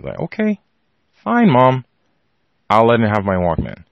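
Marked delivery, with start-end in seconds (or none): 0.2-1.8 retold childish giving-in, pitched up; grudging agreement. Child yielding to mother over the Walkman, grudging. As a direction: give in reluctantly, as a put-upon kid.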